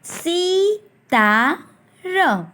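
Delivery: सी ता र spoken very slowly, ई in सी held long; slow syllable-by-syllable सी ता र; drawn-out syllables. Shown as a demonstration. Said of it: "बड़े वाले ई की मात्रा को लंबा खींचना है".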